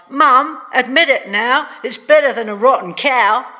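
put-on high, loud, insistent boy; boy's cry. A narrator's reading. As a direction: plead your case loudly to mum. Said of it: "Young Jack cried".

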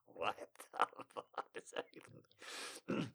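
slow hushed disbelief; incredulous whisper. Real, not acted.

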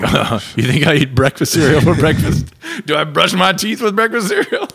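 loud, laughing mock French indignation; mock French accent. Friends teasing Thomas, laughing mock French accent. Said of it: "You're like".

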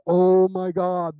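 loud, slow stunned cry; freaked out. Retold or imitated. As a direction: let it burst out in shock. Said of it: "she just freaked out, started laughing".

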